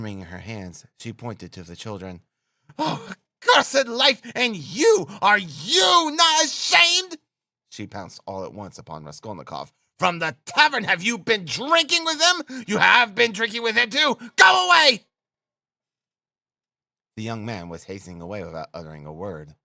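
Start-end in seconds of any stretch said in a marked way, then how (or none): 2.8-7.2 very high, drawn-out shrieking; anguished high-pitched scolding; hysterical outrage. A narrator's reading. Frantic wife turns on the stranger. 10.0-15.1 high-pitched furious screaming; frantic high shrieks; hysterical accusation. A narrator's reading. Frantic wife accuses, orders stranger out.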